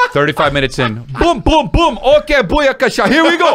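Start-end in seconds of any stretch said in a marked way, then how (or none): 1.2-3.6 put-on Jamaican accent. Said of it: "do that with my comedy".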